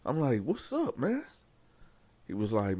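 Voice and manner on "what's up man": worried. Retold or imitated. Alarmed asking cellmate what's wrong.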